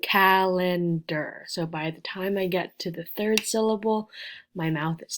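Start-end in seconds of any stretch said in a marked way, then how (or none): 0.0-1.4 "calendar" slow, with a big stretched CAL; effort on CAL. Shown as a demonstration. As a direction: lean hard on the start of "calendar", then relax. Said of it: "lot of effort saying cal".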